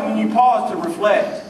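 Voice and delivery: ordinary talk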